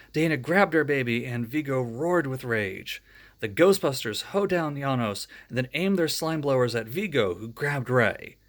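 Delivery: ordinary delivery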